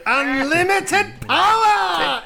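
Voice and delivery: slow, high, loud Sith cry; Emperor Palpatine. Acted. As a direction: cackle it out like an evil emperor. Palpatine quote, shouted.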